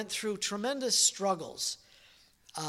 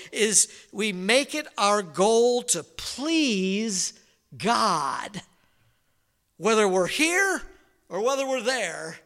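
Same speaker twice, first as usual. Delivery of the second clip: louder, higher, driving pulpit delivery; fervent preaching; 5 semitones higher, 7 dB louder than usual. Acted. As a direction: preach it out to the congregation. Preaching application at full force.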